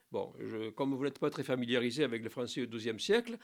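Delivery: ordinary delivery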